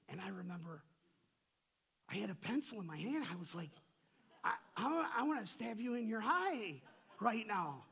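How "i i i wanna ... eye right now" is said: loud, high-pitched comic threat; mock rage. Preacher retells wanting to attack his laborer, mock rage. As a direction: blurt it out in hammed-up fury.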